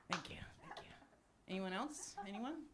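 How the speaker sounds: ordinary talk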